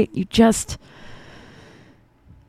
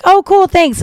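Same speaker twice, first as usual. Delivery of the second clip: bright mock-cheery thanks, pitched way up; chirpy thanks; 11 semitones higher, 4 dB louder than usual. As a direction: sing out a fake-cheerful thank you to the weird neighbor. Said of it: "And I was like".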